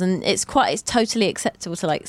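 normal talking voice

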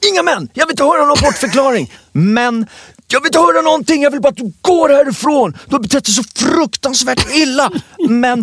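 shouting down a guest's excuses; retold furious yelling. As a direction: shut down every excuse in a rage. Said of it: "Jag har aldrig sett någon sån arg".

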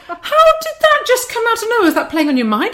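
high, loud mock astonishment; retold disbelief. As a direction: exclaim it, comically incredulous. Retells reacting to child's guilt-trip.

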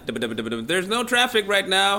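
loud mock traffic report over rotor noise; helicopter traffic reporter. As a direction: play a reporter yelling from a helicopter.

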